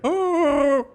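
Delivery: high, drawn-out Chewbacca howl; horrible Wookiee impersonation. Acted. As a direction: badly imitate a Wookiee's long call. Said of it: "That's my horrible Wookiee impersonation".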